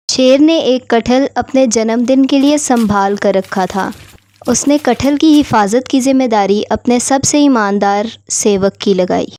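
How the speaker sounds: ordinary delivery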